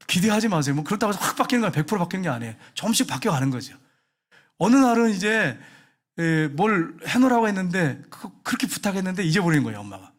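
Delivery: ordinary talk, nothing marked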